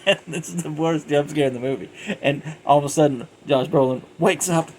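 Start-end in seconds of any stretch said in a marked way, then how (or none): 0.0-1.5 laughing through the words; high, loud, amused telling. Real, not acted. Laughing recalling the jump scare.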